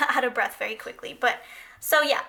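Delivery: normal talking voice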